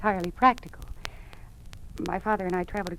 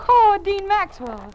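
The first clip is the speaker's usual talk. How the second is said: delighted greeting; 11 semitones higher, 10 dB louder, much slower than usual. Acted. Ann greets surprise guest.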